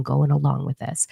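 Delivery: ordinary talk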